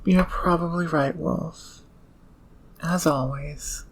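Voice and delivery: ordinary talk, nothing marked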